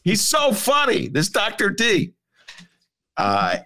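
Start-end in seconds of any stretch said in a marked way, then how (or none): 0.0-2.1 governor voice. Retold or imitated. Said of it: "He goes".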